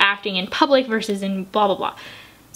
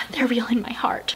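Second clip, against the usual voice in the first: whispered confession; 6 dB quieter than usual. Real, not acted. Tearing up over fictional characters.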